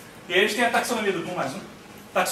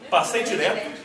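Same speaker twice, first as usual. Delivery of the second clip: ordinary talk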